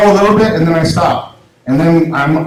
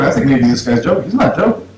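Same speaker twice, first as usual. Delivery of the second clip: ordinary talk, nothing marked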